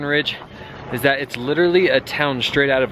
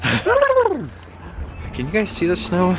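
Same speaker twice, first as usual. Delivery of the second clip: squeaky high voice; 5 semitones higher, slower than usual. Pretend. Squeaky put-on voice to camera.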